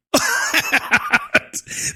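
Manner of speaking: high cackling laugh; real high-pitched burst of laughter. Real, not acted. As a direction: cackle at your own jab. Laughs at his own jab.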